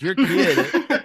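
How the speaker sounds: slow, drawn-out words broken by laughter; laughing. Real, not acted. Retelling the woman's complaint to friends while laughing.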